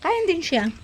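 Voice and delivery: ordinary delivery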